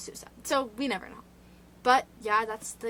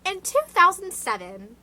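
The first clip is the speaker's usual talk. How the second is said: higher, louder, announcing the year like an anchor; newscaster voice; 8 semitones higher, 12 dB louder than usual. Real, not acted. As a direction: lean into the news anchor read. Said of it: "why do I sound like a newscaster".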